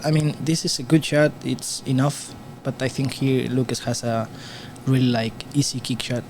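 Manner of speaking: usual speaking voice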